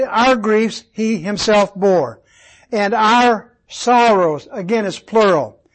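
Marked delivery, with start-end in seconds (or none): 0.0-4.5 slow, weighted "our" each time; "our" held and stressed before each noun. Shown as a demonstration. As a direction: draw out "our" to point at the plural. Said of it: "the emphasis is on the plurals".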